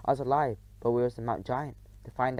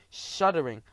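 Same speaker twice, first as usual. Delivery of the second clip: usual speaking voice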